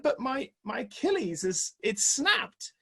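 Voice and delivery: high, strained protest; high disbelief. Retold or imitated. To the doctor about his snapped Achilles, high protest. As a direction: squeak it up in protest. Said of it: "I said".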